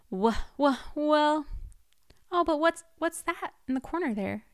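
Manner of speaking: ordinary talk, nothing marked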